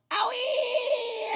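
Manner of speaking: very high, loud yelp; grandma yelping from the medicine; yelled. A narrator's reading. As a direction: yelp in sudden pain. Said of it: "Grandma yelled".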